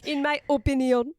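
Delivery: a made-up French lilt on opinion; mock French accent. S1 teasing S0 with a fake French opinion. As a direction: say opinion with a cheesy French flair. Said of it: "made up a French accent of opinion".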